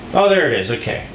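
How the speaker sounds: ordinary delivery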